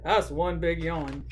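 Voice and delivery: plain reading voice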